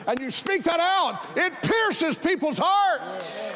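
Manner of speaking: high, loud, shouted preaching; preaching at full force. Acted. Preacher shouting the message about conviction to the church, full-force preaching. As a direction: hammer it home to the congregation.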